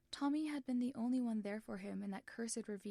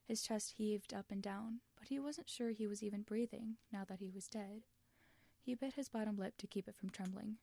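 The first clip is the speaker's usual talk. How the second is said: ordinary delivery, nothing marked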